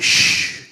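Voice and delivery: loud, sharp shush; dad's acted hush; long hushing shh. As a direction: hiss a firm shh for silence. Dad hushes the teller.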